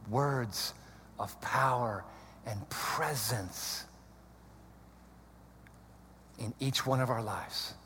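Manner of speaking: very soft, low praying voice; barely voiced closing prayer; hushed prayer. Real, not acted. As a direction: pray intimately with long silences between phrases.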